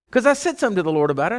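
ordinary delivery

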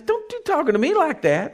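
high-pitched indignant rebuke; God. Acted. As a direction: scold back, taking no nonsense. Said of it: "And God says".

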